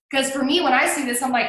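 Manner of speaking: ordinary talk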